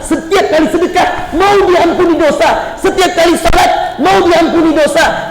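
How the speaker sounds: high-pitched, driving exhortation; forceful preaching. Real, not acted. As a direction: preach it in rising, insistent waves. Preaches at full force to congregation.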